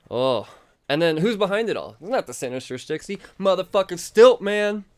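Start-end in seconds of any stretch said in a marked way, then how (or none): 3.4-4.8 higher, louder reveal; excited shout. Real, not acted. Reviewer revealing the villain, shouting. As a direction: announce it like a punchline. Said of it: "big villain reveal".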